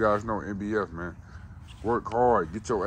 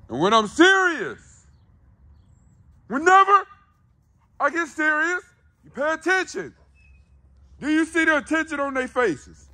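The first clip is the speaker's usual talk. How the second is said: serious yelling voice; 6 dB louder than usual. Shown as a demonstration. Owner demonstrating his serious tone to the dogs, yelling high and loud. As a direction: show the dogs how serious sounds by yelling. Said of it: "I just yelled".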